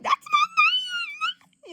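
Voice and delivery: very high, drawn-out mock wail; possessive squeal. Jealous friend claiming his pal, possessive squeal.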